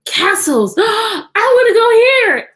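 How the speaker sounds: gasping, high and loud excitement; excited gasp. Acted. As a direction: gasp and squeal it like a thrilled girl. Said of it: "she said".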